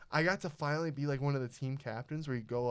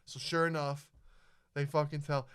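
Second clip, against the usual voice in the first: ordinary delivery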